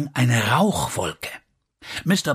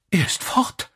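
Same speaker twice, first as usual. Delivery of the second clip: high cry of alarm; dismayed cry; 7 semitones higher than usual. A narrator's reading. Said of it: "rief Elsie".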